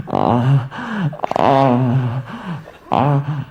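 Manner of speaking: low breathy moaning grunts; mock sex moans. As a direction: ham up three low moans. Sex noises acted out.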